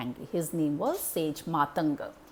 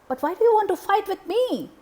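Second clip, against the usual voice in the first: surprised god; 13 semitones higher, 8 dB louder than usual. Acted. Varuna replying to the demon.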